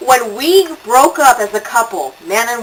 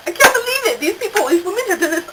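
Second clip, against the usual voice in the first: mock disbelief; 9 semitones higher, faster than usual. Shocked quote of own reaction.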